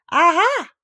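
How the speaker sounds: high, loud, gotcha cry; triumphant aha. A narrator's reading. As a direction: pounce on it, pitch leaping up. Rabbit demonstrates the triumphant cry.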